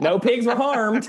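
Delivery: playful, giggly Southern voice; Southern twang. Pretend. Player selling vegan mascara, laughing Southern twang. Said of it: "We need that Southern twang".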